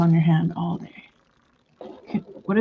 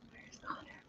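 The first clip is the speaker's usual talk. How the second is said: stage whisper; 29 dB quieter than usual. Retold or imitated. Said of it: "you go".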